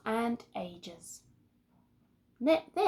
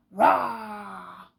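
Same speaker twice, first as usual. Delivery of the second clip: a loud playful roar; playful shouty roar; loud growl-shout; 7 dB louder than usual. Acted. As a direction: roar it out like a happy child. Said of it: "Arthur had such a lovely time".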